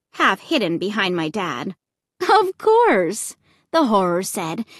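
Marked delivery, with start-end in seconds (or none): 2.2-3.4 high, cheery hostess reply; cheery assurance. A narrator's reading. Horror MC telling the family they can go, cheery. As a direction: reassure them in a high, cheery lilt. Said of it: "grinning warmly".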